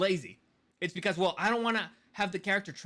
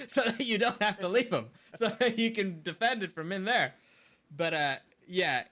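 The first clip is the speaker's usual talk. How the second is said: laughing through words; 2 semitones higher than usual. Real, not acted. Laughing after friend's joke.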